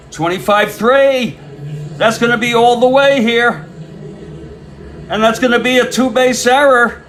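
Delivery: high, loud, mounting announcer call; excited play-by-play. Real, not acted. Announcer calling a two-base error by Wilbur, building excitement. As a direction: build to an astonished cry.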